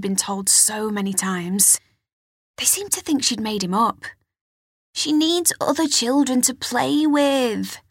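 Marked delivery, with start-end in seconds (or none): 4.9-7.8 lightly voiced aunt, higher and slow; a hint of a fussy aunt, higher; mild higher aunt voice; Aunt Hetty. A narrator's reading. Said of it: "her Aunt Hetty would say".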